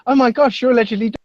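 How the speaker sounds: high, breathless amazement; mock starstruck fan; starstruck gasp. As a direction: imitate her stunned recognition. Retelling the woman's reaction again.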